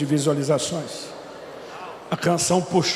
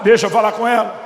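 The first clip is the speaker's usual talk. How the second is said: firm loud demand; 4 semitones higher, 6 dB louder than usual. Retold or imitated. Father insisting on talking to wife.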